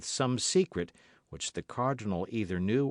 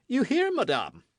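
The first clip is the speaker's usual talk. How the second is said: much higher, louder taunting question; gloating king; 6 dB louder than usual. A narrator's reading. King taunting the frightened queen, gloating. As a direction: savour her discomfort. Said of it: "said the king, who enjoyed the embarrassment".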